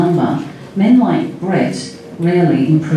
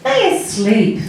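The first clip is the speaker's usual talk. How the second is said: put-on high-pitched small child; little girl's voice; 8 semitones higher than usual. A narrator's reading. Little Chrissy telling Sally, little girl's voice. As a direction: answer simply in a high kiddie voice. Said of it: "said the little girl".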